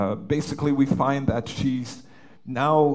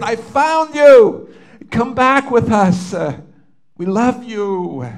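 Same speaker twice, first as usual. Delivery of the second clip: much higher and louder, slow, emotional plea; overjoyed pleading; 9 semitones higher, 11 dB louder, slower than usual. Retold or imitated. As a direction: cry it out, begging her to come home. And I started to cry.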